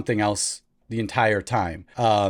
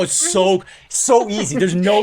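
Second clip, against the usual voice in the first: mock delight; 6 semitones higher, 3 dB louder than usual. Acting his past delighted self.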